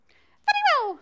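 high, loud squeaky bat. Acted. Bat agrees to help others, high loud squeak. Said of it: "said the bat".

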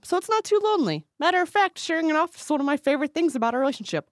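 high, gushing letter reading; over-the-top happy. Pretend. Reading listener's letter about working beside wife, overly happy. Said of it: "All right, take it down a notch".

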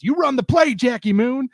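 high, loud coach's order; Woody Harrelson as coach. Retold or imitated. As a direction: yell it like an exasperated coach, mock-angry. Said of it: "Woody Harrelson's the coach, and he's".

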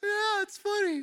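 high-pitched, forced nervous laughing; imitated awkward laugh. Pastor mimicking congregation after election joke, awkwardly laughing. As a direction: squeal out an uneasy mock laugh. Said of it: "it's like an awkward laugh".

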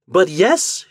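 high, bright insistence; insisting. A narrator's reading. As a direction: insist gaily. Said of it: "she said".

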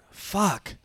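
normal talking voice

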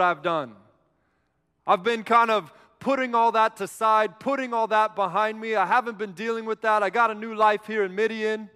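hammed-up pleading excuses; protesting. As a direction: push back with a mild whine. Acting out Moses' reluctant protest.

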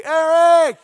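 high, loud call for a friend; yelled name. Retold or imitated. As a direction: holler the name from next door. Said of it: "I would scream at Adrian".

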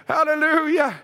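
ordinary talk

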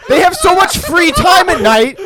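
angry shouting. Acted. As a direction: yell like an enraged slave owner. Acted angry slave owner ranting.

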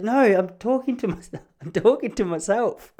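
high-pitched protesting voice; imitated exasperated wife. Wife tells husband she wasn't addressing him, high exasperated protest. Wife snaps back in quarrel.

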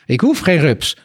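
wife rejecting her husband's offer, snippy; snappish. Acted. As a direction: snap it back at him. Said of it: "riep ze met een snibbige snavel".

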